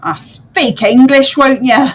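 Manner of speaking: a bird scolding in a grumble; grumpy magpie. Acted. Magpie scolding Bo, slow and cranky. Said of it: "the magpie just sort of goes".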